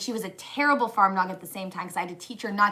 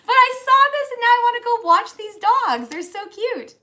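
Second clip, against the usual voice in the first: high, loud gushing over cute dogs; 13 semitones higher, 8 dB louder than usual. Real, not acted. Wife gushing on stream about cute dogs, high and excited. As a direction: let it spill out, thrilled.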